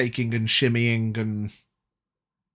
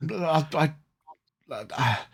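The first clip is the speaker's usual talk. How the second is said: flustered priest panicking; 6 semitones higher, 3 dB louder than usual. Acted. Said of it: "I'm panicking".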